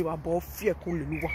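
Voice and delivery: ordinary delivery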